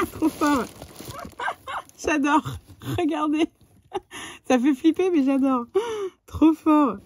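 high, loud gushing over a gift; excited delight. Real, not acted. Vlogger unboxing a friend's Halloween gift, high and gushing. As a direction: squeal with real delight at each treat.

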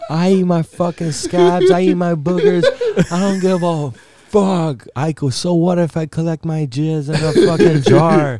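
hammed-up, shameless confession of gross habits; mock defiant boast. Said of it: "I'm gonna be like".

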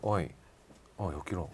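quiet, menacing low growl; low menacing mutter. Shown as a demonstration. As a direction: growl it under your breath. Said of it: "もっと怖く".

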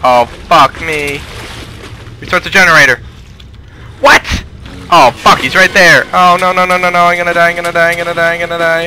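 high, loud terrified screaming; panicked yelling. Real, not acted. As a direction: yell like you're being chased. Chased by the monster.

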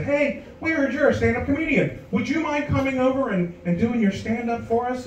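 high, sweet polite caller voice; lightly mimicked caller asking a favor. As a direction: ask it like a hopeful nursing home caller.

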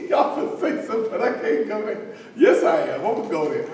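playful banter through chuckles; laughing, teasing. Real, not acted. Preacher teasing the congregation about putting own daughter on blast, laughing. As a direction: joke along, laughing between phrases.

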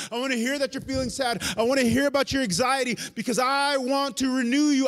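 loud, high, pleading invitation; inviting God voice. Retold or imitated. God calling the broken to come near, urgent tender invitation. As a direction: draw them close with urgent love. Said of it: "he goes".

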